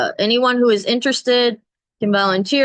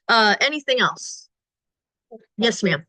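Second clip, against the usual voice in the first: ordinary talk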